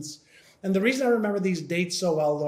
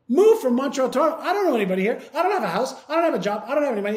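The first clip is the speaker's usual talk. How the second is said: panicked protest; 6 semitones higher, 5 dB louder, much faster than usual. Retold or imitated.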